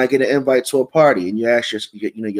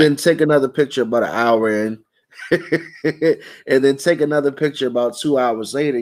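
telling it through chuckles; amused, with real laughs breaking in between phrases; laughing; 2 semitones higher than usual. Real, not acted. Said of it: "I'm, I'm laughing".

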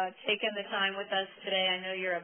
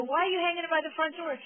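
incredulous; 10 semitones higher, 6 dB louder, faster than usual. Retold or imitated. Questions husband about hung snake.

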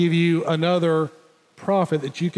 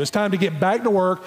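ordinary delivery

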